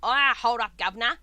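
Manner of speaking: loud mock British geezer with a rasp; raspy British voice. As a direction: call it out in a raspy British accent. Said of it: "raspy British voice".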